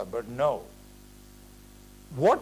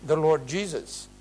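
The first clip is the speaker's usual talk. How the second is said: ordinary talk, nothing marked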